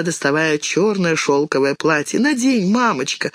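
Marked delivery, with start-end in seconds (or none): 2.2-3.3 put-on eager child. A narrator's reading. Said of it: "сказала Алина".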